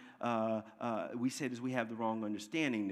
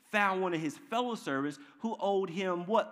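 normal talking voice

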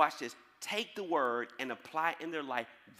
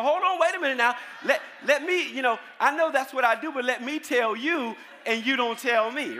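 loud, high mock scolding of the young cashier; hammed-up stop-right-there shout; retold rebuke; 9 semitones higher, 10 dB louder than usual.

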